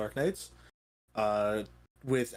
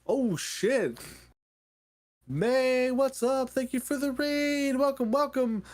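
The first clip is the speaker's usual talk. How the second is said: excited greeting; 11 semitones higher, slower than usual. Real, not acted. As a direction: cheer the raiders in. Greeting incoming raid excitedly.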